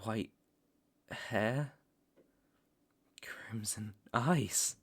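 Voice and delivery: slow whispered question; stunned whisper. Acted. Hunter realizes who turned the fledgling, slow stunned whisper. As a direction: breathe it out slowly in disbelief. Shocked recognition of the sire.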